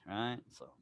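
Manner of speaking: ordinary talk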